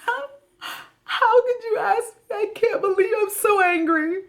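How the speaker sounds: high, hammed-up mock sobbing; fake crying. Mocking a cheater who cries as a diversion, fake crying. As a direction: put on tearful outrage to dodge the question. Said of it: "Evelyn starts to cry, a diversion".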